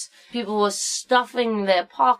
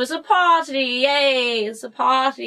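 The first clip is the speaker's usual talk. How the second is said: mock party cheer; 5 semitones higher than usual. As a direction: whoop it up like a silly party guest.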